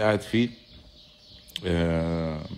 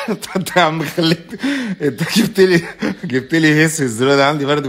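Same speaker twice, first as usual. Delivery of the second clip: laughing; 7 semitones higher, 7 dB louder than usual. Real, not acted. Laughing at a viewer's comment.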